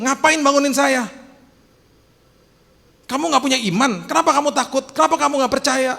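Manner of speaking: high, loud exasperated scolding; Jesus rebuking. Retold or imitated. Jesus to disciples in the boat, loud high scolding. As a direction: scold them for waking him. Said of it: "Maksudnya dia tuh adalah gini".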